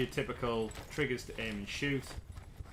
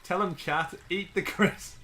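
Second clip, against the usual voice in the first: loud; 6 semitones higher, 5 dB louder, slower than usual. Real, not acted. Urging friend to eat crisp.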